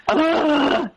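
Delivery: high mock wailing ugh; hammed-up graveside wail, pitched high; full-blown wail. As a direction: imitate mom bursting into a theatrical wail. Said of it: "burst into a full-blown wail".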